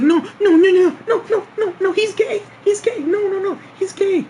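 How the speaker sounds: very high, squeaky frantic imitation; mock panicked pleading. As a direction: plead in a hammed-up falsetto panic. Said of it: "she's sitting there panicking".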